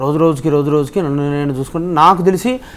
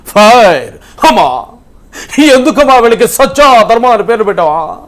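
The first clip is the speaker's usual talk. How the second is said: higher, louder, slow booming film-hero delivery of the dialogue; Sai Kumar impression; 9 semitones higher, 7 dB louder than usual. Mimicry artist performs the film hero's fiery dialogue for the hosts, high and booming. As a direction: roar out the Police Story lines like a fiery cop hero. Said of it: "చెప్తాను సాయి కుమార్ గారి dialogue".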